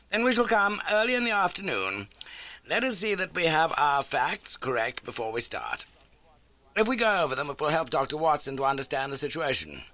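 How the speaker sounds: a crisp, brisk detective; Holmes. A narrator's reading. Holmes introduces the landlady to Watson, brisk.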